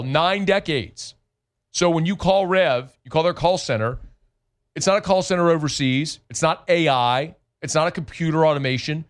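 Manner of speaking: upbeat radio host pitching a sponsor; live ad read. Acted. Host reads the internet ad to listeners, upbeat and punchy. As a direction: sell it like a local radio spot.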